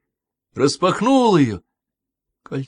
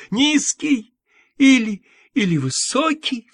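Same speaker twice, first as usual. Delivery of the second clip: much higher, with all her strength on «высокий»; pressing on высокий; 10 semitones higher, 4 dB louder than usual. A narrator's reading. Patient testing the nanny, pitching hard on «высокий». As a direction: lean every ounce into the word «высокий». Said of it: "Все свои силы вложила Эвридика в последнее слово".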